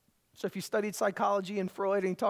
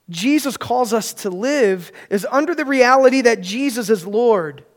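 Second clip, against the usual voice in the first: loud, driving sermon voice building to a proclamation; full-force preaching; 9 dB louder than usual. Acted. Pastor urging the congregation not to fear, loud preaching. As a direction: preach it with conviction to the whole room.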